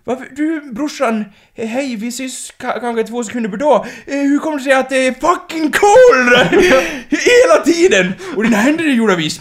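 lightly voiced sister, giggling through it; mock high voice with laughter. Sister teasing her brother, high mock voice. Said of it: "quoting the character's song".

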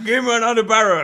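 rowdy demand. Acted. Friend acting a Viking at the bar, rowdy bellow.

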